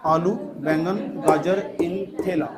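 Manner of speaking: slow, drawn-out list for memorising; mnemonic recital. Shown as a demonstration. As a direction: recite it slowly, word by word. Teacher repeats the memory trick. (याद कराने के लिए धीरे-धीरे बोलते हुए)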